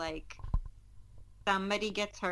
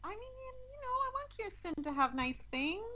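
a touch sad; 14 semitones higher than usual. Real, not acted. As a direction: sound gently sorry about it, voice raised. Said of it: "You sound sad by that".

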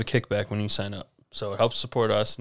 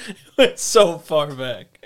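high, loud laughing question; 5 semitones higher, 8 dB louder, slower than usual. Real, not acted. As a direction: ask it while cracking up. Cracking up at date slip.

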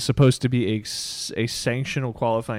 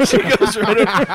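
laughing; 7 semitones higher, 7 dB louder than usual. Real, not acted. Laughing after French bit.

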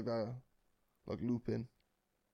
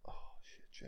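whisper; 16 dB quieter than usual. Real, not acted. Sudden realization, hushed.

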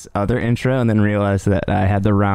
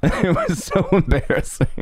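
laughing it off, a little higher; embarrassed laugh; 7 semitones higher than usual. Real, not acted. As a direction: giggle through the confession of a goof. Embarrassed about the flat recording.

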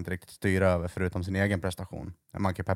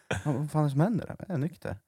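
higher, mock disbelief at the sober friend; mock shocked guy; 7 semitones higher, 4 dB quieter than usual. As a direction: play the stunned guy, higher pitch. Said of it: "Han bara".

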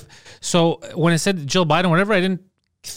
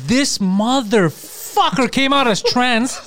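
mock astonishment; 7 semitones higher, much slower than usual. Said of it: "And I was like".

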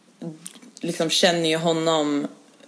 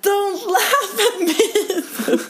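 high-pitched, drawn-out mock shriek; squealed protest, imitating your own scream; retold scream; much slower than usual. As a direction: shriek it slowly, half laughing. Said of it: "jag typ skriker på honom".

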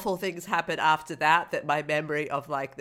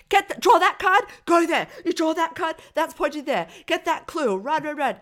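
high, frantic string of orders; urgent, pitched-up instructions; bossy board game; 10 semitones higher, 3 dB louder than usual. Retold or imitated. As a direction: fire off commands like a hectic game. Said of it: "the board game is like".

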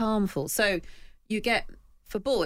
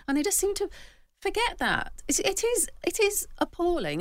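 indignant, much higher pitch; getting angry; 12 semitones higher than usual. Real, not acted. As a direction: pitch climbing with real outrage. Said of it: "I'm getting quite angry".

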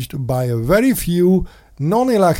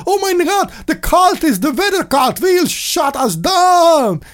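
high, shrieking fake alarm; squealing mock horror; mock panic; 13 semitones higher than usual. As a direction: ham up a hysterical believer. Mocking climate-alarm panic.

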